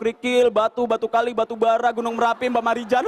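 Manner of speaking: ordinary talk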